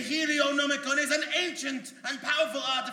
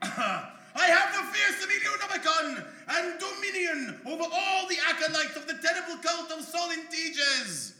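megalomaniac rant; 7 semitones higher, 4 dB louder than usual. Acted. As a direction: relish your new power. Power-mad boast.

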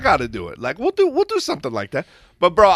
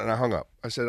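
usual speaking voice

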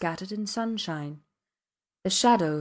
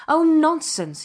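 loud, high burst of impatience; sharp impatient dismissal; 8 semitones higher, 8 dB louder, slower than usual. A narrator's reading. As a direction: brush it off impatiently. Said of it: "he said impatiently".

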